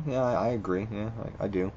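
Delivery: ordinary delivery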